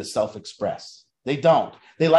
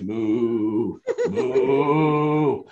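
lowered mock cow moo; cattle moo; 4 semitones lower than usual. Players herded down hallways, mocking moo like cattle. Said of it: "It's literally like cattle".

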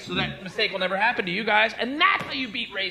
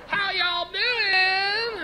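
high, very drawn-out Southern drawl; mock South Carolina twang, pitched way up; Southern accent; 13 semitones higher, much slower than usual. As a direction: stretch out a hammed-up Georgia greeting. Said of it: "accents, like South Carolina and Georgia".

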